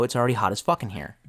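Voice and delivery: ordinary talk, nothing marked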